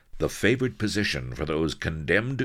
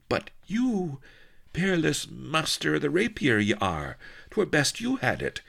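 very high, adoring protest; ardent pirate queen; 13 semitones higher than usual. A narrator's reading. Pirate queen pressing the sword on her hero, high and adoring. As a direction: plead in high theatrical archaic style.

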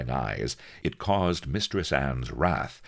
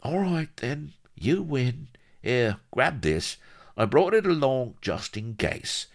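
higher-pitched, grudging shyster voice; grudging little shyster; 11 semitones higher, 3 dB louder than usual. A narrator's reading. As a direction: give in as a crafty little rogue. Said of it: "groaned and dug into his pocket".

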